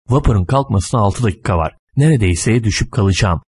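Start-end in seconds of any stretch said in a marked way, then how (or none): none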